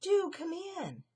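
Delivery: high, loud delighted mouse greeting; delighted welcome. Acted. Annie welcoming her city friend, delighted and high. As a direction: squeal a happy welcome. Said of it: "she said".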